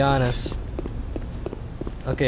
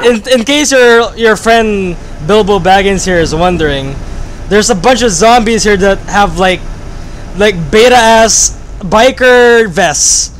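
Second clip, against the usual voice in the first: ordinary talk, nothing marked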